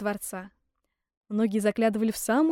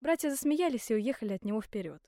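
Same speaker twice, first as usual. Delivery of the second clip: ordinary delivery, nothing marked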